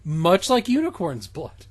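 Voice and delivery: ordinary talk, nothing marked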